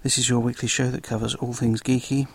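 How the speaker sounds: ordinary delivery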